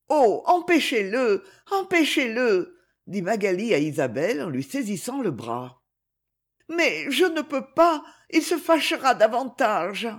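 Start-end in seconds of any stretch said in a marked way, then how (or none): 0.1-2.8 high, alarmed appeal; pleading cry, pitched up; urgent plea. A narrator's reading. Magali begs Isabelle to intervene. 6.7-10.1 high, helpless objection; anxious protest. A narrator's reading. Isabelle helplessly refuses the plea.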